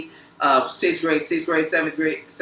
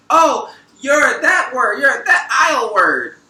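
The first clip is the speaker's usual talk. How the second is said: slow, higher, louder taunt; mocking accusation; 7 semitones higher, 6 dB louder, slower than usual. Quoting the woman who called him the word, in a mocking accusing taunt. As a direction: drag it out like throwing an insult. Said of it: "she calls me that".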